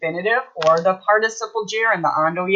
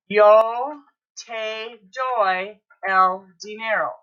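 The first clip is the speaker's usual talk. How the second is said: very slow, word by word; slow teacher's dictation with gaps; slow modelling; much slower than usual. Shown as a demonstration. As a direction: spell out the sentence one word at a time. Teacher models a Spanish sentence slowly.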